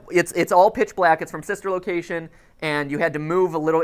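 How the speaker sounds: usual speaking voice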